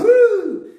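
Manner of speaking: high, loud "uh" going over a hill; put-on thrill squeal; mock stomach-drop whoop. Shown as a demonstration. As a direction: whoop as the car drops. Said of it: "aí quando passa".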